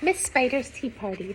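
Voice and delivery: usual speaking voice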